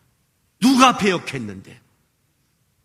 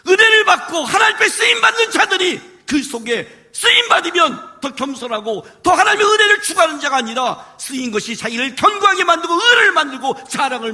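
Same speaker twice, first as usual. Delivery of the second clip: preaching shout; 9 semitones higher, 3 dB louder than usual. Real, not acted. Preacher rebukes proud believers.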